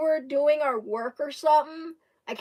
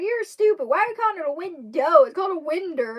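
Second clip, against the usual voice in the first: loud, high, indignant scolding; mock-angry, raised and loud; retold mad; 7 semitones higher, 8 dB louder, faster than usual. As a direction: imitate a schoolboy insisting he's right. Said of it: "he actually got mad at me".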